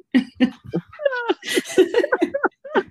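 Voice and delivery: high, breathless laughing fit; hard laughing. Real, not acted. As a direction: laugh until it nearly cries. Laughter after joke.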